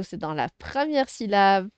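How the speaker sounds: ordinary talk, nothing marked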